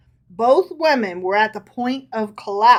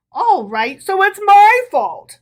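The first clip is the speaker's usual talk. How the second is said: much higher, louder sarcastic jab; 9 semitones higher, 10 dB louder than usual. A narrator's reading. Rabbi to Herschel, loud sarcasm. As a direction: sneer it. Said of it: "said the rabbi sarcastically".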